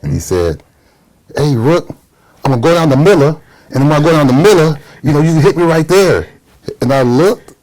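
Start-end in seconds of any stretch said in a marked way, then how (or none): 1.4-6.3 high Louisiana-accented imitation; Hot Rod Williams. Teammate telling rookie the play, high Louisiana accent. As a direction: call the rookie in a thick Louisiana accent, pitched up. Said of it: "John Hot Rod Williams from Louisiana".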